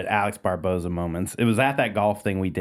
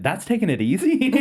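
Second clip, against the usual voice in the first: higher, rising tone of amused disbelief; mock incredulous; 7 semitones higher than usual. Friend imitating a comic who heard the roast, mock incredulous. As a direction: question it as if astonished.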